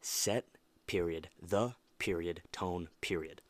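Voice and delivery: "Set, period, the, period, tone, period" each word clipped and final; punctuated motto; word by word with full stops. Shown as a demonstration. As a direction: spell out the stops between words. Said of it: "all the punctuation".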